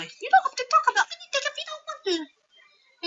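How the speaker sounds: high-voiced friend offering comfort; high gentle character voice.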